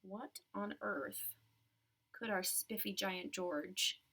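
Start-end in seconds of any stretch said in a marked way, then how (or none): none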